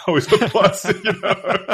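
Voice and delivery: laughing through the words. Real, not acted. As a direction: giggle it out, amused. Laughing at grossing out table.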